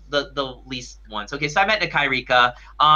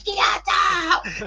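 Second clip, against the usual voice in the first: high-pitched imitated shout; excited cheer. Fan imitates idol jumping at his praise, high excited shout. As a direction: squeal it out in celebration.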